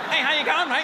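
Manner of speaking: lightly put-on Australian accent; a breezy Australian hello; mocking an Australian greeting, lightly voiced in an Australian accent. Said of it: "shove carrots in your mouth when you're talking".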